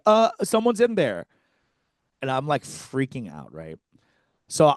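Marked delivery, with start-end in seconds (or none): 0.1-1.2 high, hesitant mock-innocent voice, much higher; nervous Eric. Friend telling the queue someone's inside, high and sheepish. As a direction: squeak it out like a guilty lookout. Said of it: "And Eric's like".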